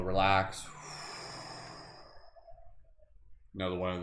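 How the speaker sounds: guided breathing meditation. Acted. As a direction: ease them into deep breaths.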